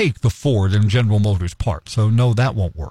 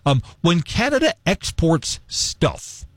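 ordinary delivery

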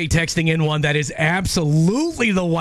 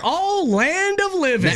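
put-on higher, slower, old-lady exclamation; a grandma's fond astonishment; imitating grandma; 10 semitones higher, slower than usual. Host imitating her Iowa grandma's exclamation, mock old-lady voice. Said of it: "She'd be like".

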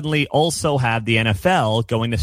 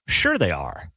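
sarcastic; 8 semitones lower, 3 dB quieter, slower than usual. Real, not acted. Sarcastic answer to own question.

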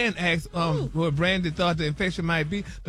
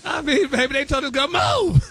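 laughing disbelief; 7 semitones higher, 4 dB louder than usual. Real, not acted. Host cracking up at story.

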